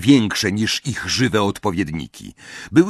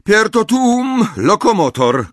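higher, louder incantation; shouting spell; 10 semitones higher, 6 dB louder than usual. Acted. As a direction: cry the spell out. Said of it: "krzyknęła profesor McGonagall".

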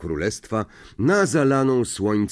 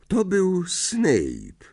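ordinary delivery, nothing marked

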